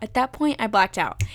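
ordinary talk, nothing marked

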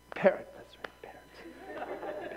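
hushed recall under the breath; whispered to self. Real, not acted. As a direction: whisper the word as it comes back. Searching for word aloud.

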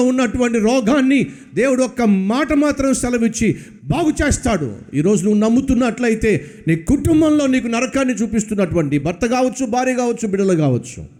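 high, driving sermon cadence; preaching at full force. Acted. Preacher promising the congregation miracles, impassioned. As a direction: build the promise line after line.

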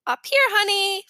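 high little boy playing husband; best husband voice. A narrator's reading. Fudge answering Sheila from the tree, husband voice. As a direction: call back sweetly as a pretend husband. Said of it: "in his best husband voice".

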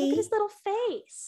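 high cooing voice. Real, not acted. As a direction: coo at the kitty's face. Cooing over the cat.

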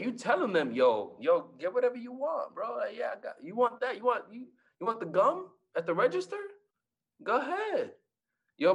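high, eager treating everyone; flashing cash at the store, high and eager; excited big spender. Retold or imitated. Generous shopper voice.

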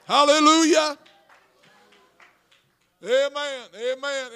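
loud, rising shouts of praise; full-force preaching. Acted. Pastor rousing his congregation, loud preaching. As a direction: preach it out at the top of your voice.

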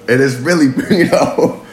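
ordinary delivery

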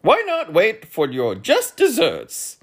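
high, loud, slow earl voice; earl, mouth full. A narrator's reading. Earl with mouth full answering the others, high and slow. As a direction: speak slowly as the earl with food in his mouth. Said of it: "mumbled the earl indistinctly".

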